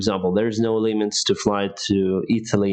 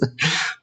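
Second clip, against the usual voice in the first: laugh; 8 semitones higher than usual. Real, not acted. Laughs at own joke.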